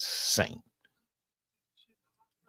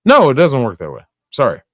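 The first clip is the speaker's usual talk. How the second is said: ordinary talk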